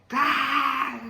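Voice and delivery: high drawn-out "ah"; mock charging yell. Storyteller mimics opponent rushing him, high yell. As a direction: imitate an opponent rushing in screaming.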